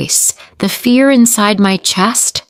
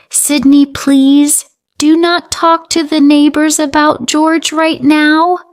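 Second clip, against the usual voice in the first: urgent plea; 9 semitones higher than usual. Acted. Mother urgently begs her daughter to stay silent, pleading.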